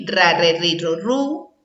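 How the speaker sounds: "ra, re, ri, ro, ru" sounded out one by one; "ra, re, ri, ro, ru" drilled as separate syllables. Shown as a demonstration. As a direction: chant "ra, re, ri, ro, ru" for children. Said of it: "con la R".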